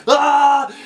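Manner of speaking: high, hammed-up scream; sudden comic shriek; mock scream. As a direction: burst into a fake horror-film scream. Acting the CGI scene's screaming.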